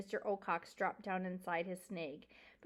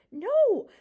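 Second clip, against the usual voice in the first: high, loud panicked cry; 11 semitones higher, 14 dB louder than usual. A narrator's reading. Frightened mouse stopping the porcupine, high loud cry. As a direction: cry out in alarm. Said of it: "Poppy cried".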